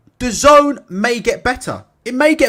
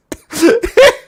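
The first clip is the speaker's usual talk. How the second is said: high-pitched burst of laughing; helpless high giggle; real laughter; 10 semitones higher, 4 dB louder than usual. Real, not acted. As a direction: lose it laughing. Cracking up after subscriber numbers demand.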